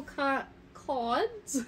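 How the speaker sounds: the word in a playful British accent; British-accented slip. Real, not acted. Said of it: "I want to slip into my British accent".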